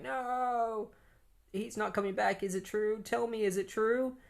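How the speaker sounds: high pleading disbelief; distraught Linda. Retold or imitated. Linda pleading with John about Wally, high distraught voice. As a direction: beg for the truth, voice up high.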